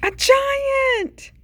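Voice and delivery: high, loud cry of alarm. A narrator's reading. Seed suddenly facing a giant, high alarmed cry. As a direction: jump way up in pitch and hold it.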